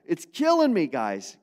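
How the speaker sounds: pained Paul. Acted. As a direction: cry out like a heartbroken apostle. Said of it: "He even says".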